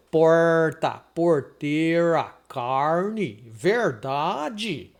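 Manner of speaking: slow, stretched caipira retroflex R. Shown as a demonstration. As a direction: drawl each word with a rural Brazilian caipira R. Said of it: "o som é o R do caipira".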